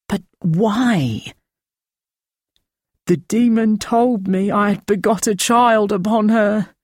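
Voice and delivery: high, thin, rasping feverish man. Acted. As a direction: rasp it out thin and weak. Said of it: "His voice a thin, rasping trickle".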